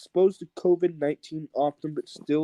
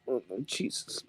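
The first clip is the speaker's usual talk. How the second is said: ordinary talk, nothing marked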